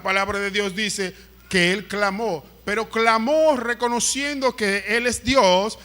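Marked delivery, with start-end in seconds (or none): none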